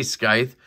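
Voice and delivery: scythe pronounced "skythe", K sound after the S; hard C. Shown as a demonstration. Reviewer defending his habit, scythe with hard C. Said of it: "I say scythe".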